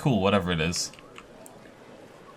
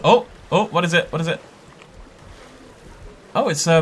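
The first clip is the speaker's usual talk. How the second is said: normal talking voice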